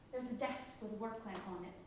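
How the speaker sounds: ordinary talk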